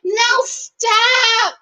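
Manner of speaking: high, mocking voice of a woman loving the fight; mocking Sarah. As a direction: parody a woman egging two men on, hamming it up. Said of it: "She wants to be in the middle and be like".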